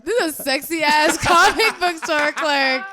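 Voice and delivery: laughing tease; loud, laughing banter. Real, not acted.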